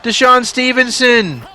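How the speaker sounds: high, loud hyped call, slowing on the block; excited shout. Acted. Gamer hyping the go-ahead play, shouting play-by-play. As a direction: yell it like the arena just erupted. Clutch moment in the game.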